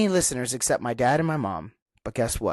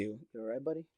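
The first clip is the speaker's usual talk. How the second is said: hushed to dog; 13 dB quieter, faster than usual. Real, not acted. Checking on the grumbling dog.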